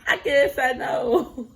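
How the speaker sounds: laughing through the words; laughing repeat. Real, not acted. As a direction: repeat it while cracking up.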